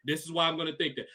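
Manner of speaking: ordinary delivery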